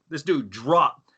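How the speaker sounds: normal talking voice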